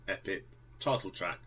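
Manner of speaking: usual speaking voice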